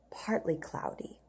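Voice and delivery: soft whispered Partly cloudy. Shown as a demonstration. Teacher whispering partly cloudy to the class, whisper. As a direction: whisper it like a secret.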